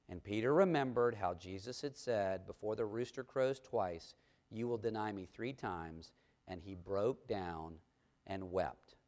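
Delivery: low, hushed narration; quiet, grave reading, very low at the end; solemn scripture reading. A narrator's reading. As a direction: let the verse sink down softly to its sad close.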